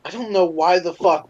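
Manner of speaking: normal talking voice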